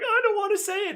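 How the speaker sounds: high, choked-up voice; mock tearful boy. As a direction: squeak it out holding back tears. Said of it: "And he's like".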